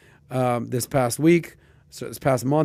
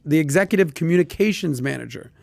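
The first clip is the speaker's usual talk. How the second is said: usual speaking voice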